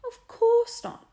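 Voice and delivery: high, slow, loud wavering reply; high, loud, slow quavering denial; wavering reassurance. A narrator's reading. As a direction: start to reassure, voice cracking. Dinah answering.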